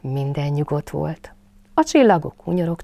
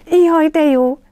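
much higher, louder cry of delight; jubilant Piglet; 11 semitones higher, 10 dB louder, slower than usual. A narrator's reading. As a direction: squeal with joy. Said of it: "újongott Malacka".

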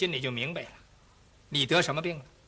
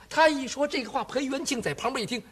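ordinary delivery

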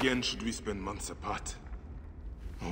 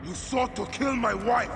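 much higher, much louder furious yell; furious shout; 13 semitones higher, 5 dB louder than usual. Acted. Husband yelling at the man who tried to kill his wife, furious. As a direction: hurl the accusation at him in rage. Confronting wife's would-be killer.

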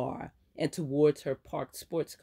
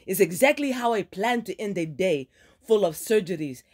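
furious friend ranting, higher and louder; angry scolding friend; 4 semitones higher, 4 dB louder than usual. Acted. Ileana ranting at Aaron about her ruined dinner, angry. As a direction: let her rip into her reckless friend.

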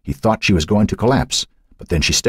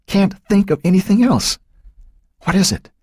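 put-on young girl voice; 14 semitones higher than usual. A narrator's reading. Becky, scared girl in the dark.

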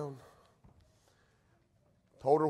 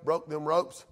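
usual speaking voice

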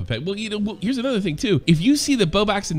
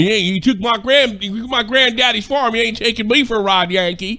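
slow mocking Midwest drawl; mocking Midwestern farmer; 4 semitones higher, 3 dB louder than usual. Mocking his dad's Midwestern clients, slow drawl. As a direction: sneer it like a resentful Midwest farmer. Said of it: "It's like they talk fucking slow".

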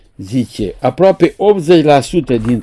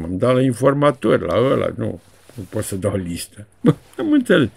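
ordinary talk

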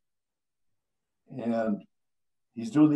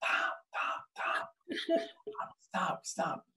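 whispered, hushed pleading; urgent whisper; 12 dB quieter, much slower than usual. Retold or imitated. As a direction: hiss it so the mic won't catch it. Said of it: "I says".

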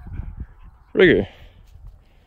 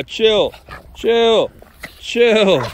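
higher, firm calls of chill; firm command to dogs; 7 semitones higher, much slower than usual. Real, not acted. Owner tells the excited dogs to chill, raised voice.

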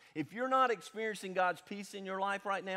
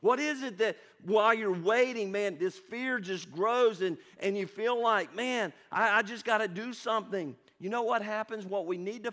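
louder, pressing preacher's voice; full-force preaching; 5 semitones higher, 7 dB louder than usual. Acted. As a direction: push the question at the room. Pastor preaching about fear.

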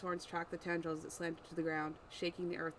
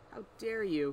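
louder, snarled indignant question; snarling outrage; 3 semitones higher, 5 dB louder than usual. Acted. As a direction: snap it back like a bared fang. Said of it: "crawled into a snarl".

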